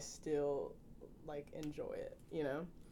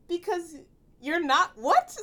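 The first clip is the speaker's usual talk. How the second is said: much higher and louder, baffled; incredulous; 11 semitones higher, 13 dB louder, slower than usual. Retold or imitated. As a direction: hit it with disbelief. Retold incredulous reply, high loud.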